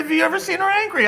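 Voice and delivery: high, fast laughing retelling of the question; mock father. Imitating Julie's dad repeating the question, high and laughing. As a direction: fire it back quick through a grin. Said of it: "He said".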